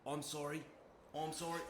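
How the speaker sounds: normal talking voice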